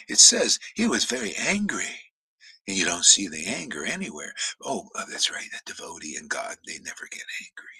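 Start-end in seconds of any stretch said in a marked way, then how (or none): none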